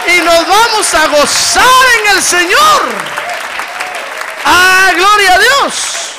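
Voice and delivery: loud, high, slow preaching cry; preaching at full force. Acted. Preacher rousing the congregation, loud and slow.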